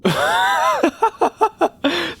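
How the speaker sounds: high squealing laugh; delighted high laugh; high giggle. Real, not acted. Laughing at Adderall joke.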